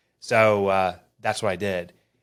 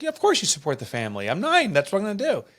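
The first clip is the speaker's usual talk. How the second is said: put-on much higher, matter-of-fact little girl; nine-year-old daughter; 9 semitones higher than usual. Dad quoting his young daughter, a higher, breezy child voice. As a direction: lightly voice a child shrugging it off. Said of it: "She's like".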